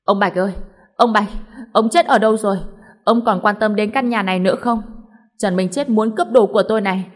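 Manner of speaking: wife screaming for her husband's help; shrieking. Acted. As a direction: shriek for your husband to come. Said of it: "hét toáng lên".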